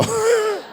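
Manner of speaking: high burst of laughter. Real, not acted. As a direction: squeal of a laugh. Laughs after joke.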